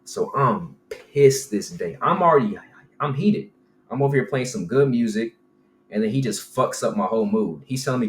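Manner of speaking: ordinary talk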